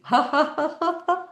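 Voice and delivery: high ha-ha laughter; exit-row laughter exercise; forced laughter. Shown as a demonstration. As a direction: laugh hard on purpose. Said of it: "They might be behind you".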